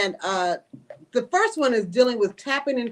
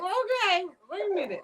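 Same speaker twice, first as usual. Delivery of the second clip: higher-pitched, unsure stalling; hesitant; 5 semitones higher, 4 dB quieter, faster than usual. Retold or imitated. Listener voicing someone hesitant about being called, hesitantly. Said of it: "you're hesitant about it".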